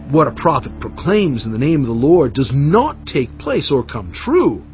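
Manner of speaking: ordinary talk, nothing marked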